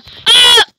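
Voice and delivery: sudden high scream. Real, not acted. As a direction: shriek in shock. Scream at game moment.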